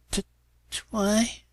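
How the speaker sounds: stammering. A narrator's reading. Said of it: "Spike gulped".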